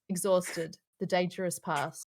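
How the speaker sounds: ordinary delivery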